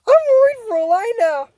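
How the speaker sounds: high-pitched fretting; anxious fan's high wail; worried outcry. Real, not acted. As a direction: fret out loud, pitched right up. Frets about character in danger.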